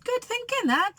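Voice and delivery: a giddy crook approving the plan; Hugh's voice. Acted. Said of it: "said Hefty Hugh".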